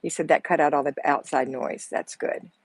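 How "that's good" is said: ordinary talk, nothing marked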